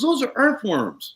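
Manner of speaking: high, slow, astonished; drawn out in disbelief; amazed old man. Retold or imitated. Old neighbor spotting worms, slow high amazement. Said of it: "He goes".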